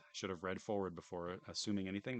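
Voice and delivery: usual speaking voice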